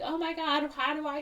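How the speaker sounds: high, girlish mock delight; thrilled daughter. Daughter gets a corsage from mom, high thrilled gushing. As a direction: gush in a high voice.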